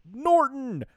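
high, loud enraged wrestler's bellow; hammed-up furious scream; angry mock yell. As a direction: yell the rival's name, then let out a roar. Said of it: "Train is pissed and yells".

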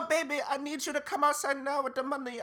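imitated female caller. Woman calling him outside for money, imitated female caller.

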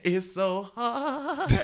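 slow, high, whiny mock sorrow; mock lament. Kid mockingly whining about leaving his friends, slow and high. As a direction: ham up the heartbreak.

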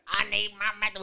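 thin, raspy whine. Retold or imitated. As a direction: rasp it like a junkie. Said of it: "with a little raspy voice".

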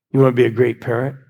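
ordinary talk, nothing marked

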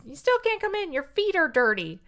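high, prim witch voice; witch refusing. Retold or imitated. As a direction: refuse her in a lifted mock-witch tone. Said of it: "And the witch says".

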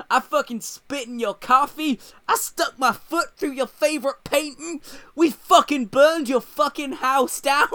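loud, high mock tirade; imitated rant. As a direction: shout each boast as if acting out an unhinged rant. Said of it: "goes off on some rant".

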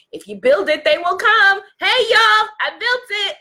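high, loud mock calling to a crowd; naive call-out. Course creator calling to imagined customers who never came, naive call-out. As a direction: holler out to everyone, high and hopeful. Acting out her naive past thinking.

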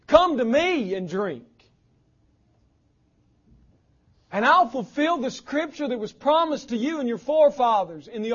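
loud, fervent preaching cry. Acted. Preacher voicing Jesus calling to the crowd, loud impassioned cry. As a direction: call it out over the crowd with emotion. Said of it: "crying out".

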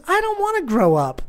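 put-on high, whiny little-boy protest; imitated defiant child. Son defying dad in the dressing room, imitated defiant child.

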